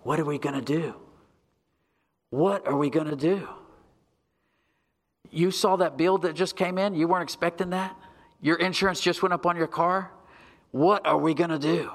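whispering devil. Retold or imitated. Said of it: "he whispers in your ear something to this effect".

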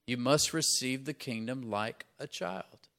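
ordinary talk, nothing marked

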